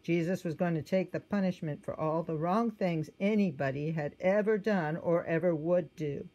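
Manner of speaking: plain reading voice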